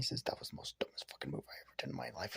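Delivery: fast, breathy self-scolding whisper under the breath; muttering to himself in disbelief at his own blunder; whispered inner thought. Acted. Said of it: "in his mind".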